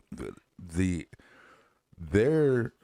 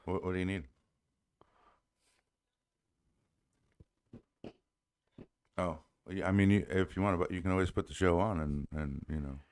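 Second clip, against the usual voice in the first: softer, talking aside on the phone; 8 dB quieter, faster than usual. Real, not acted. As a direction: quietly answer your wife away from the mic. Answering wife's call mid-show.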